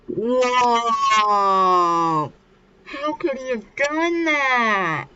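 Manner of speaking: high, drawn-out astonishment; acted amazement. Everybody sees Genta's new hairstyle, hammed-up amazement. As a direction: stretch it out in hammed-up shock. Everybody reacts to the dramatic haircut.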